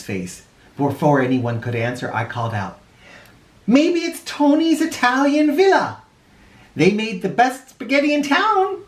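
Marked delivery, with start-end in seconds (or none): 3.7-8.8 put-on high, eager child's voice; excited kid. Boy calling out to the president, high and eager. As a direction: eagerly pitch the idea to the president. Child narrator calls out suggestion.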